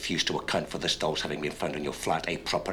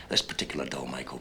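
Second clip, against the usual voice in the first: normal talking voice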